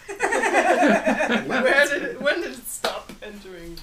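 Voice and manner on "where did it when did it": loud laugh through the words; cracking up while asking. Real, not acted. Bursts out laughing at question.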